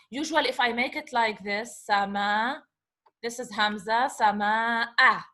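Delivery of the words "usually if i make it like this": ordinary talk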